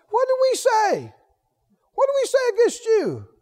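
high-pitched mock indignant questioning; mock disrespectful protest. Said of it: "Do you hear the tone of disrespect?".

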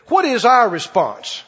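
ordinary delivery

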